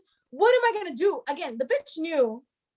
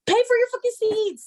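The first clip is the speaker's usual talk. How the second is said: heated angry outburst; 8 semitones higher, 4 dB louder than usual. Real, not acted. Ranting to friends about the seat-stealing woman, angry high yell. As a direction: voice jumps high and loud in exasperation.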